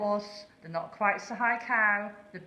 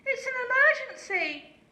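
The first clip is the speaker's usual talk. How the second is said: very high, loud alarm call; urgent shout; 8 dB louder than usual. A narrator's reading. Lazy owl fakes an emergency to the horse, shouting. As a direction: sound the alarm in a panic. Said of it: "shouted Aussie".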